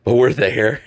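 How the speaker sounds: ordinary talk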